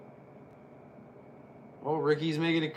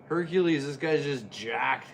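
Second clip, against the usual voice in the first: usual speaking voice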